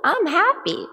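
loud, high, snapping at him; what's your problem, rising to a shout; snapping at husband. Pretend. Wife venting at grumpy husband, loud and high. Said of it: "I wanna look at him and go".

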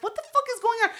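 a high bewildered yelp; high shocked cry. Retold or imitated. Retelling meeting the stall guard, high shocked cry. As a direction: pitch it way up in disbelief. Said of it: "And I was like".

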